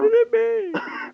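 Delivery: very high, slow bellowed slogan; hard sell ad. Pretend. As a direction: scream the brand name like an infomercial. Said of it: "advertising voice".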